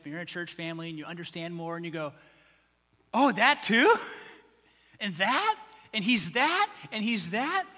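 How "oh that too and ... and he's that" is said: acted wonder, each question swooping upward; amazed discovery. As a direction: pile up astonished questions, starting loud. Believer voiced discovering more about Jesus.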